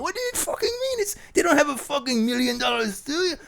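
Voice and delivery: very high, baffled [name]; very high [name] disbelief; [name] impression. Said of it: "play all three parts".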